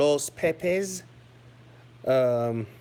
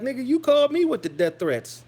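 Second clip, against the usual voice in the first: pitched-up incredulous comeback; retold retort; 9 semitones higher, 3 dB louder than usual. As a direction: fire back your own reply, higher. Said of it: "I'm like".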